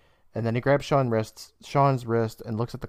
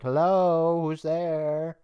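raised, drawn-out call; calling out warily; 6 semitones higher, much slower than usual. Retold or imitated. Narrator voices Billy hearing noise in the graveyard, calling out. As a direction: call into the dark, a little higher. Calling out in retold film scene.

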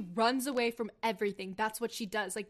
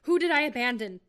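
louder, demanding question; 9 dB louder than usual. Acted. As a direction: demand an answer. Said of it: "Percy demanded".

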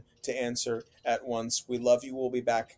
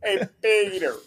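Family Guy voice; 7 semitones higher, 5 dB louder than usual. Acted.

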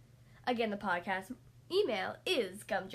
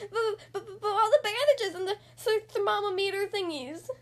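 higher, whimpering stammer; scared stammer; 7 semitones higher, 5 dB louder than usual. Acted. Splat pleading with his mom, frightened stammering whimper. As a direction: stutter in fright, near tears.

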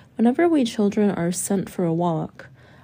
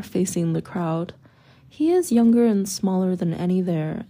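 ordinary delivery

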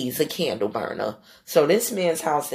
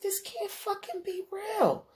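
much higher, slow, aghast; can't believe her eyes, pitched way up; horrified disbelief; 5 dB quieter, slower than usual. Retold or imitated. Seeing roaches cross the sink, horrified disbelief.

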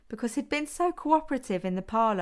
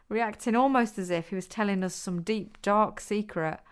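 ordinary delivery

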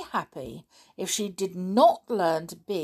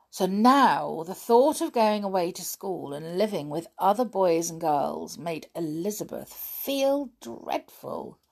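ordinary delivery

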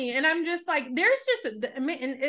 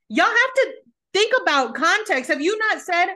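much higher, louder exasperated lecture; 9 semitones higher, 5 dB louder than usual. Real, not acted. Lecturing reality TV viewers, loud and high. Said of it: "telling viewers off".

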